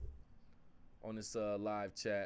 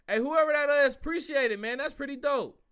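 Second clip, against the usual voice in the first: excited thanks; 8 semitones higher, 11 dB louder than usual. Real, not acted.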